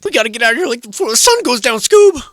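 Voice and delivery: hammed-up cartoon panic before sundown; scared cartoon sidekick. Panicked Shaggy-style line to Scoob.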